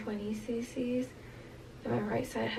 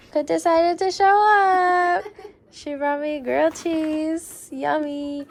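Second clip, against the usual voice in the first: crying; 6 semitones higher, 10 dB louder, slower than usual. Real, not acted. Teary over mom's grilled cheese.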